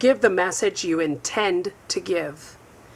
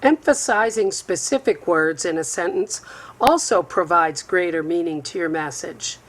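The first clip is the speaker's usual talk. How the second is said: ordinary delivery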